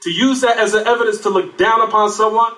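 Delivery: ordinary delivery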